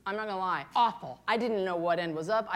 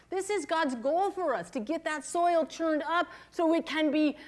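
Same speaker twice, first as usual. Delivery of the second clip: higher, animated sermon delivery; lively preaching; 6 semitones higher than usual. Acted. Pastor telling the women how God helped her, animated preaching. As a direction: preach it up with energy.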